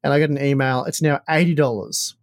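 ordinary talk, nothing marked

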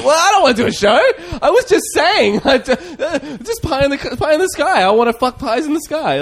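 high, loud whiny mock protest, laughing through it; squeaky, laughing imitation of a flustered friend; mocking Glenn. Friend retelling Glenn's reaction, in a high laughing mock whine. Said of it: "Where he was like".

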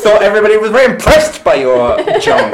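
loud, high courtroom accusation; mock prosecutor. Co-host playing court at Mister Gulliver, mock-prosecutorial. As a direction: declaim it triumphantly, on the edge of laughter.

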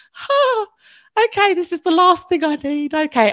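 high-pitched gasp settling into strained acceptance; dismayed reaction. Retold or imitated. Retelling dismay at unwanted news.

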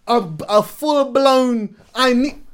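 excited. Real, not acted. High excited arguing voice.

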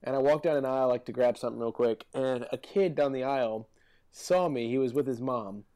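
ordinary talk

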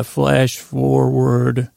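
ordinary delivery

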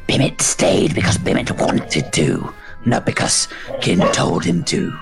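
low, whispered creature voice; whispering dragon. Acted.

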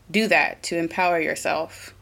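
normal talking voice

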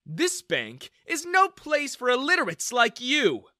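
loud, high-pitched insult; contemptuous outburst. A narrator's reading. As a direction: throw the customer out scornfully. Manager throws customer out.

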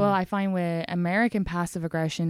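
ordinary delivery

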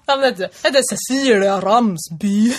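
slow, drawn-out Hälsingland dialect; mock rustic Hälsingland speech; Hälsingland accent. Shown as a demonstration. Podcaster imitates co-host's home dialect, slow Hälsingland accent. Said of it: "Hälsingmål".

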